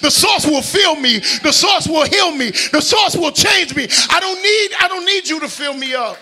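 loud high sermon climax; shouted declaration of faith; preaching shout. Real, not acted. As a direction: proclaim it at full force. Sermon climax, shouting about Jesus.

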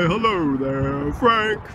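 normal talking voice

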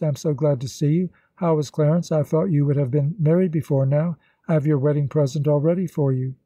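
plain reading voice

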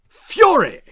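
explosive high, loud shout; purple-faced roar on one word; furious shout. A narrator's reading. Angry father bursts in on his daughter, shouting. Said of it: "shouted her purple-faced father".

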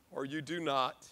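low, near-murmured final alternative; hushed ultimatum. Real, not acted. Preacher giving the congregation a choice, hushed and low. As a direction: drop suddenly low and very soft. Ultimatum ends in stark contrast.

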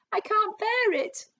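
very high, loud, drawn-out wail; anguished cry. A narrator's reading. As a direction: wail it slowly in despair. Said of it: "cried Missus Twit".

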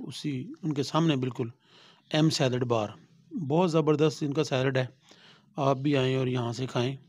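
eating between words; talking while chewing. Real, not acted. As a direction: mouth full of food. Seen 1: Spoons salad from cup into mouth, chewing while talking throughout.

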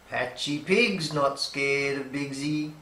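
usual speaking voice

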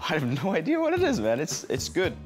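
usual speaking voice

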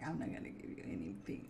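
a hint of a grumpy cow in a whisper. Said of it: "She's like".

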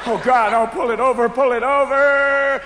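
loud wail, last word stretched out; mock panicked cry, drawn out; panicked driver. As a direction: wail in hammed-up dread. Stoned driver panics seeing police.

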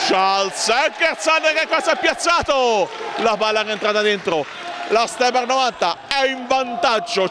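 high excited shout at the basket; excited commentary. Real, not acted. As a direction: burst out as the shot drops. Basket scored, commentator erupts. (urlo da telecronaca)

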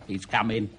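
ordinary talk, nothing marked

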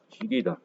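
second 죽이다 pronounced 쥐기다, fronted vowel; vowel-assimilation demonstration. Shown as a demonstration. Teacher showing vowel assimilation, 죽이다 as 쥐기다. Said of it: "이렇게 바뀌었다면".

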